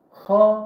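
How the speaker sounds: H rasped from the throat, loud; throaty H. Shown as a demonstration. Teacher showing the throaty Arabic H. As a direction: growl the H from the throat. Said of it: "gırtlaktan hırlatıyorsun".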